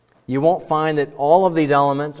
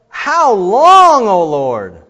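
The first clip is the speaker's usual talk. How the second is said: much higher, louder cry; anguished lament; 7 semitones higher, 11 dB louder, much slower than usual. Shown as a demonstration. Psalmist lamenting to the Lord, loud anguish. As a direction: cry out how long in anguish.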